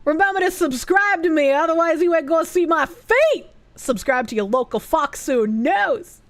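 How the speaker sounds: Boston street hail, dropped r's; very Bostonian accent. Acted. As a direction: do a broad, hammed-up Bostonian. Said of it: "I read it in a very Bostonian accent".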